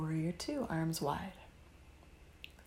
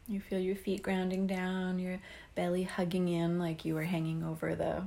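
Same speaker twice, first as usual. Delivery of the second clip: normal talking voice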